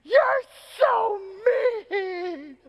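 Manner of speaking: very high, mock-crying little girl; hammed-up tearful shriek of an upset kid; passionate child's wail. Daughter to dad after the frog is crushed, passionate wail. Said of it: "without a pause in complete passion".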